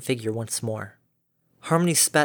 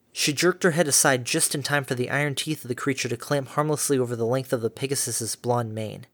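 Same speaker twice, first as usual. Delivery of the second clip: usual speaking voice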